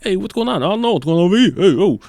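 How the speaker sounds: put-on loud, high wiseguy New York accent; hammy Brooklyn gangster banter. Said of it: "talking, like".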